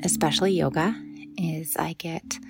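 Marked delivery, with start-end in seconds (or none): 1.4-2.4 whisper. Acted. Soft aside in a meditation.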